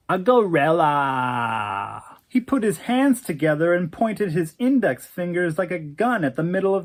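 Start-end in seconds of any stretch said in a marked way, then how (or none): 0.1-2.0 very slow, drawn-out airy drawl. Acted. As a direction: stretch each word lazily. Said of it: "in that airy drawl of his".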